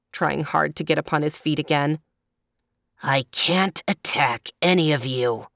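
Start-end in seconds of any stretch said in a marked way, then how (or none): 3.0-5.5 lightly voiced leopard snarl; a touch of snarl, like a sulky big cat. A narrator's reading. Leopard admitting he can't attack, mildly snarling. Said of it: "snarled the leopard".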